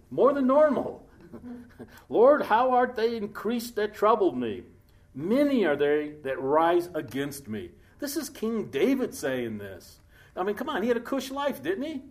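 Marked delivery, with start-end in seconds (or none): 2.1-7.7 full-voiced scripture reading, slowing at the end; solemn preacher's reading; preached scripture. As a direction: read the verse like a plea from the pulpit. Reading the psalm with force.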